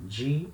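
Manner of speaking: "G" said as "djee"; a single "G" syllable with an English j sound; soft Rio sound. Shown as a demonstration. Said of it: "Can you notice the difference?".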